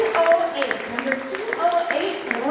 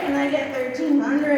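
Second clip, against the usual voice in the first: normal talking voice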